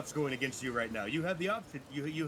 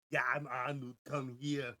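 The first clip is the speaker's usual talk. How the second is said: light Austrian accent, mock Arnold; a loose imitation of Arnold's Austrian accent; barely-there Austrian Arnold accent; Arnold Schwarzenegger impression; 6 dB louder, faster than usual. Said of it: "my Arnold Schwarzenegger is more or less just mocking".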